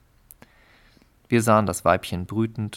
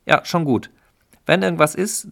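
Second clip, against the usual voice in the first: higher and louder, brusque brush-off; irritated husband; 8 semitones higher, 5 dB louder than usual. Acted. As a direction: cut the neighbour short. Hostile husband brushes neighbour off.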